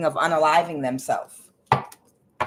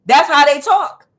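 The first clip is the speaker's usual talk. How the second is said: loud emphatic repeat; 8 semitones higher, 9 dB louder than usual. Real, not acted. Telling viewers how ancestors speak, loud and emphatic. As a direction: repeat it much louder and higher.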